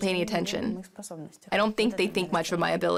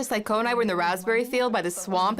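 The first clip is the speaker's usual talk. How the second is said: ordinary talk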